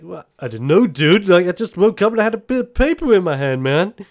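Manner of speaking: high, loud, fast defensive whine; flustered protest. Acted. Kickflip defending himself to his grandma, high and flustered. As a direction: protest your innocence in a rush. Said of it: "And he's just like".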